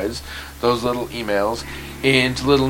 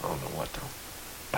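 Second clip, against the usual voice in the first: whisper; 6 semitones lower, 17 dB quieter, slower than usual. Real, not acted. Quiet trailing aside.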